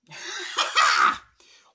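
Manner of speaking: loud, high cackle; high burst of laughter. Real, not acted. Laughs at own question.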